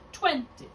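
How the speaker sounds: Twenty punched high on the first syllable; Twenty with a short, unstressed ending; unaccented ending. Shown as a demonstration. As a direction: stress TWEN, let the final y fall away. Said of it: "we'll know it's not accented".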